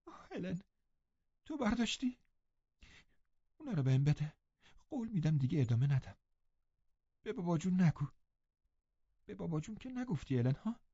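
put-on high, soft, anxious girl's voice; a frightened girl calling her nurse; lightly voiced upset girl; distressed young girl. Catherine begs Ellen.

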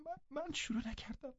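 high, soft broken sobbing; sobbing denial. Acted. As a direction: choke the denial through sobs. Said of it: "با حقحقی که نشانهٔ دلشکستگیش بود".

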